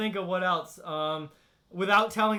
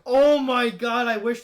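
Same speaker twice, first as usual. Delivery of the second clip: delighted outburst; 3 semitones higher, 8 dB louder than usual. Real, not acted. Streamer reacting to a viewer's viral parody story, loud delighted exclamation.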